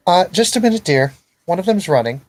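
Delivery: very high, loud call from the alley; far-off daughter. Acted. Daughter calling back from the alley mid-hunt, high and loud. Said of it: "you hear from the alleyway".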